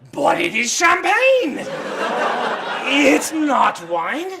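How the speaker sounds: an exasperated Frenchman laughing at the idea that champagne is wine; bad French accent. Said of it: "like the stereotypical bad Frenchman accent".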